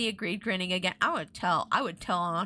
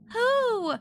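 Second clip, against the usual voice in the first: high, urgent breathless question; 13 semitones higher, 5 dB louder than usual. A narrator's reading. Chris begs her twin to tell her, breathless. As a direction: gasp it out, high and eager. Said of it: "Chris said breathlessly".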